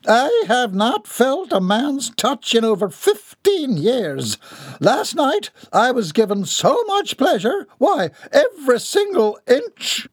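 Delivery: very high, grand dowager voice; haughty old Baroness, rapturous. A narrator's reading. Baroness telling her son of last night, grandly rapturous. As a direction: savour the confession in a lofty high pitch.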